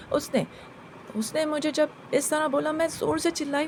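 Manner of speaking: plain reading voice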